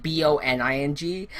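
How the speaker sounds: B O N I N G spelled letter by letter. Shown as a demonstration. Telling a friend what she misheard, spelling B O N I N G. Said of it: "I said, like".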